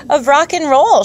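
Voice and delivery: a high, proud mock howl. A narrator's reading. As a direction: belt it out like it's obvious. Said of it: "she howls".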